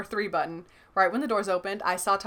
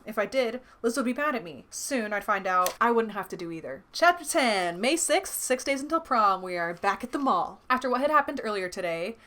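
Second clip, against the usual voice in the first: ordinary delivery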